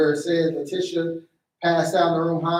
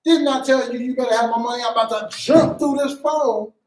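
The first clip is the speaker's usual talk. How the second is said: high, loud hammed-up scolding; mock furious pimp; 6 semitones higher, 4 dB louder than usual. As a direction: yell at Jezebel like a pimp over money. Acting an angry pimp role.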